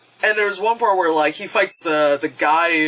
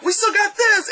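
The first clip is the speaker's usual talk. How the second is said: character's determined line. Retold or imitated. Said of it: "Mako was like".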